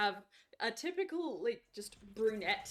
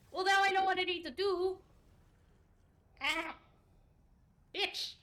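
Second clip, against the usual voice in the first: high, loud exasperated cry; real frustration during the fight; frustrated outburst; 10 semitones higher, 7 dB louder than usual. Real, not acted. Gamer yelling in frustration during a duel, loud.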